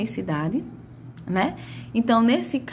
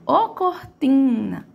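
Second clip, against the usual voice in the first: higher, slow call of "ô cortina" with a long TI; calling, stretched TI; 4 semitones higher, 3 dB louder, much slower than usual. Shown as a demonstration. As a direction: call it out, holding "ti". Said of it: "é o meu ti". (chamando "ô cortina" com o TI arrastado)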